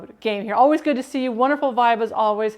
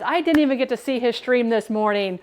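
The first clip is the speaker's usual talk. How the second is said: ordinary delivery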